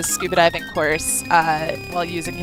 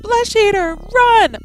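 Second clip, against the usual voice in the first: high, loud panicked shout; panicked yell; 8 dB louder, slower than usual. Acted. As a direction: scream the warning. Warning of monster.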